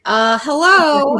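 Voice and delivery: meme monkey imitation. Podcaster mimicking a meme for co-hosts, slow meme-monkey voice. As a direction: drag it out high like the monkey meme. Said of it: "weird cat monkey that's just like".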